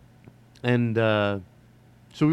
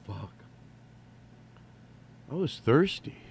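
usual speaking voice

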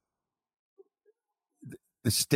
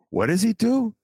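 pitched way up and louder, in disbelief; incredulous repeat; 6 dB louder than usual. Real, not acted. Host repeats the question to his co-host in loud disbelief. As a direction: fling the question out high and loud.

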